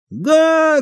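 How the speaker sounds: very high piercing shriek; clown's shriek. A narrator's reading. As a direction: shriek over the roar. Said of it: "крикнул он, стараясь перекричать рёв". (визгливый клоунский выкрик)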